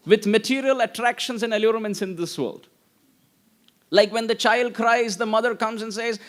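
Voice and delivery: usual speaking voice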